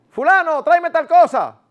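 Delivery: high, loud curt command to her husband; bossy order. Shown as a demonstration. A wife orders her husband like a boy, curt loud command. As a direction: order him around like a kid. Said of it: "como si fuera un muchacho".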